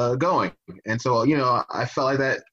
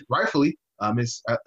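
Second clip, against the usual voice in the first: ordinary talk, nothing marked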